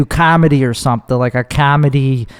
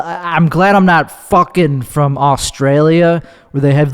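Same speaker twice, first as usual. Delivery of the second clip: ordinary talk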